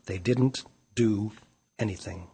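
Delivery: ordinary delivery, nothing marked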